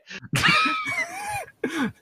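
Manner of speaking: shrieking laugh. Real, not acted. Laughing at her own kitten-bite joke, shrieking. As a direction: break into a shrieking cackle.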